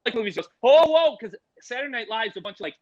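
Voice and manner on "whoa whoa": high, loud movie-star whoa. Retold or imitated. Actor sees the tongs and hooks, high loud amazement. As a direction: burst out impressed, imitating an actor. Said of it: "[name]'s just like, like movies".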